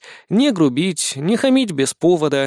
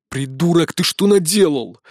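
holding back a shout; 3 semitones higher than usual. Acted. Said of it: "шёпотом рявкнул".

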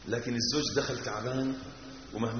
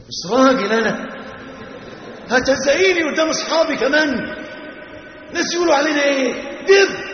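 angry yell; 11 semitones higher, 9 dB louder than usual. Retold or imitated. Wife yelling at husband before friends.

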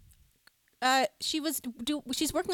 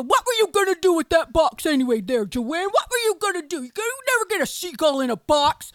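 louder exasperated dad voice; Ernie impression; 5 semitones higher, 8 dB louder than usual. Dad scolding mom in the car, exasperated. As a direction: tease her like a baffled husband. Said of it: "You had Ernie down pat".

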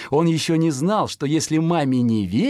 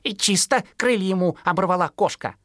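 fledgling remarks; 7 semitones higher than usual. Acted. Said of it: "сказал Пудик".

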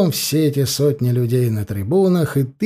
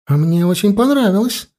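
delighted; 6 semitones higher than usual. A narrator's reading. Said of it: "с искренним восторгом проговорила Екатерина".

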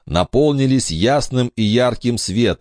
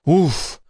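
relieved sigh; 9 semitones higher than usual. Acted. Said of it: "вздохнул Брэндон".

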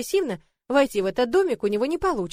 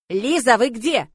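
slow, much louder call; loud male shout; 8 dB louder, slower than usual. Acted. Lord calling out for Liza, loud and slow. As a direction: shout across the yard for her. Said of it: "громкий мужской крик".

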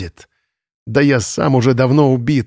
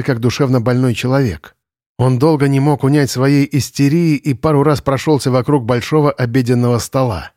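usual speaking voice

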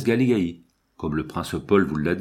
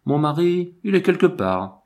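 higher, louder, naive answer of a foreign lady; foreign countess; 8 semitones higher, 3 dB louder than usual. A narrator's reading. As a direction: answer innocently, unaware of the double meaning. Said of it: "dans son ignorance des finesses de notre langue".

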